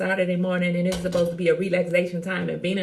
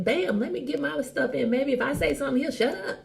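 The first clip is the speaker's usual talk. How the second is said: scheming inner voice; 6 semitones higher than usual. Retold or imitated. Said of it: "I'm like".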